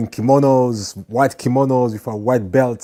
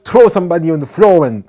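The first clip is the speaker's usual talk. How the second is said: loud, high protest; indignant kids; 7 semitones higher, 10 dB louder than usual. Retold or imitated. Judo kids objecting to the teacher about running away, loud and high. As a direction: protest like eager kids denied a fight.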